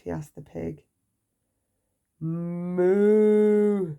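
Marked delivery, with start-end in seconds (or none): 2.2-4.0 long, louder cow moo; pig mooing. A narrator's reading. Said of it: "said the pig".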